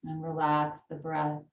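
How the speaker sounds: calm yoga teacher voice; unhurried breathing instructions; guided breathing. Acted. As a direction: talk them gently through the breath.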